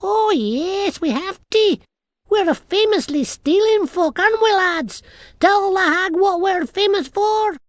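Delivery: very high, loud little-man voice; rally the lads in a piping Scots voice; boisterous tiny Scottish man. A narrator's reading.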